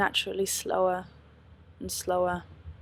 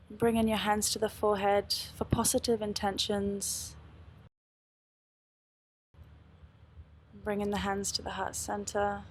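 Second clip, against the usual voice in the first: calm, unhurried meditation guide; guided meditation; 4 dB quieter than usual. Acted. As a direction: lead them gently into stillness. Closing meditation guidance.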